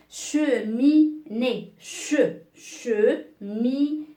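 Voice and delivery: "cheminée" stretched out slowly, syllable by syllable; slow dictation. Shown as a demonstration. Teacher dictates "cheminée" slowly to young pupils. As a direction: spell it out with your voice, very slowly. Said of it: "teacher repeats word for dictation".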